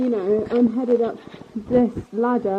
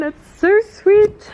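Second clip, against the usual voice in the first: high, slow gushing over something cute; 11 semitones higher, 6 dB louder, much slower than usual. Real, not acted. Vlogger showing off the tiny trullo, gushing high and slow. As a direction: coo it out, drawn long and high.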